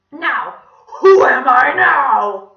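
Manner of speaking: loud, high, drawn-out squawk; terrible villain voice. A narrator's reading. Villain starting the next round of his game, creepy. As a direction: stretch the question out menacingly. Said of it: "his terrible voice once again".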